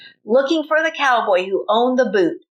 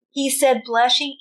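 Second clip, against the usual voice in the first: usual speaking voice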